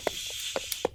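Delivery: long unvoiced shushing; soft hiss for quiet; hushing shh. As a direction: shush a noisy passing car. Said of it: "tell the car to be quiet".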